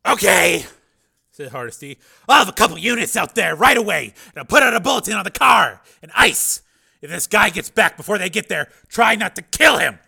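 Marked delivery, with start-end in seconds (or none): 0.1-0.7 loud, high barking chief; gruff police chief. Acted. 2.3-10.0 loud, high barking chief; gruff police chief. Acted.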